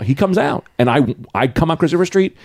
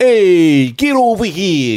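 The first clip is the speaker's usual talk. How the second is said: loud, slow Italian-American holler, higher pitch; put-on Italian-American; 4 semitones higher, 3 dB louder, much slower than usual. Grown kids who decided to talk so, mock Italian-American. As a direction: call out like a Jersey Italian wise guy. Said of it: "did not talk like that".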